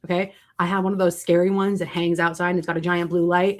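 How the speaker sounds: ordinary talk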